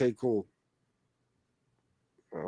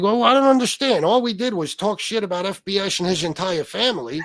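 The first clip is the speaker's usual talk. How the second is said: higher-pitched mock victim voice; whining impression of an aggrieved innocent; Pizza; 6 semitones higher than usual. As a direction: play a wronged, baffled complainer. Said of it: "I did that in Pizza's voice".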